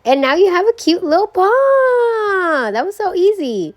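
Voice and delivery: high, loud, drawn-out squeal; cutesy delight. Real, not acted. Showing viewers the finished paw, high cutesy delight. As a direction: coo over it, stretching the last word.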